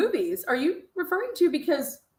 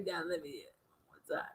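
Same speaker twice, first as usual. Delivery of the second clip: fast, soft, unvoiced whisper; 10 semitones lower, 20 dB quieter, faster than usual. Real, not acted. Host whispering to replay the clip. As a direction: breathe it out quickly.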